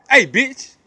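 ordinary talk, nothing marked

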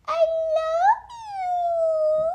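squeaky high coo, stretched out and loud; high baby-talk voice to the dog. Shown as a demonstration. Coaxing his dog Stitch to bark, squeaky high coo. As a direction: sing it up high so the dog barks back. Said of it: "he only barks when I be like".

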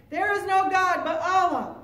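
loud, high-pitched fanatical cry; shouting. A narrator's reading. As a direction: shout it out forcefully. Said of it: "his knife and shouting".

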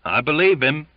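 high, loud exclamation; crying out. A narrator's reading. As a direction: cry out your agreement. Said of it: "cried voices".

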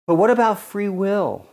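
quoting the free-will crowd, a touch mocking; imitating an objector. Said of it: "that's what we hear".

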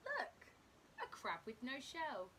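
usual speaking voice

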